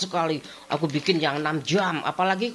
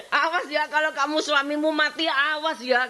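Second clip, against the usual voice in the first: higher, teasing warning; mock threat; 12 semitones higher than usual. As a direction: wag a finger at her. Drohende Mutter zitiert.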